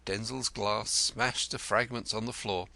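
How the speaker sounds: plain reading voice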